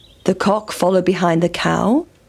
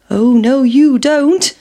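higher voice, climbing in pitch; defiant pancake; 4 semitones higher, 5 dB louder, slower than usual. Acted. Pancake escaping the frying pan, defiant. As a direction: build upward into cheeky defiance.

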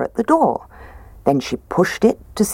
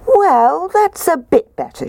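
put-on much higher storybook girl voice; Goldilocks; 14 semitones higher, 3 dB louder than usual. Goldilocks tests the medium chair, high-voiced. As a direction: lift it high, mildly approving.

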